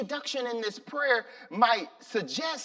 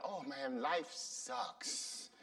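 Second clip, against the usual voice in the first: honest complaint; 5 semitones lower than usual. Shown as a demonstration. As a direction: sigh it out, lightly worn down. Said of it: "tell them".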